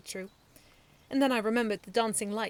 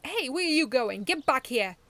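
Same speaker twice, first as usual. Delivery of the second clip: loud, higher chasing call; shout; 5 semitones higher, 10 dB louder than usual. A narrator's reading. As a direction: shout after the runner. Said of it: "Medaby shouted".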